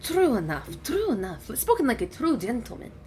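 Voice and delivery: soft, lilting dragon voice; quietly commend him as a gracious dragon; dragon, approving. Acted. Dragon praises Gadri's answer, softly approving.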